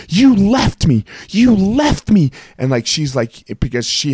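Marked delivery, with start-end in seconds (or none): 0.1-2.3 high, drawn-out accusing wail; high-pitched mock scream, louder on the stressed word; imitated screaming wife. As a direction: mimic a hysterical partner yelling the accusation. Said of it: "just starts screaming at us".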